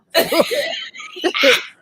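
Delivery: shrieking giggle; high squealing laugh. Real, not acted. Laughing at her own Sookie joke, high squeal.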